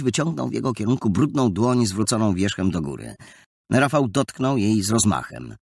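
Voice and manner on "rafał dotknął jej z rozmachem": plain reading voice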